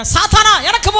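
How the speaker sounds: loud, high rebuke; rebuking shout. Retold or imitated. Preacher rebuking the flatterer as Satan, loud shout.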